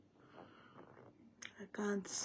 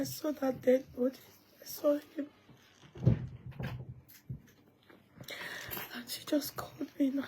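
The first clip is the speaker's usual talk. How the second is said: high, louder tearful voice cracking; real crying; 8 semitones higher, 5 dB louder than usual. Real, not acted. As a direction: blurt it out through tears. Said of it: "Why are you crying?".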